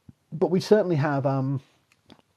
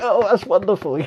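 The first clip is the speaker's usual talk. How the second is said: gushing delight; 9 semitones higher, 11 dB louder than usual. Retold or imitated. Audience reacting in mock delight.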